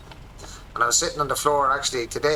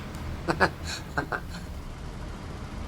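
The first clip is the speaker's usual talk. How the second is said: breathy laugh; 6 semitones higher, 7 dB quieter than usual. Real, not acted. Breathy laugh after story.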